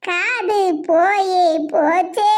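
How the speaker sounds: very slow, low mock lament; drawn-out teasing chant; gloating taunt. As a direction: stretch every word out in glee. Gloating over the lost ear.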